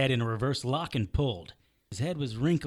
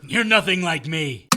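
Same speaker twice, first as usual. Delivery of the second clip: louder flat rejection; angry denial; 4 semitones higher, 8 dB louder than usual. Acted. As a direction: shut him down. Hero rejects villain.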